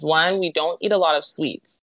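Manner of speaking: ordinary talk, nothing marked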